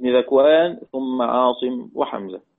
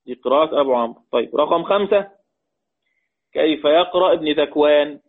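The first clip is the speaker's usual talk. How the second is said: ordinary delivery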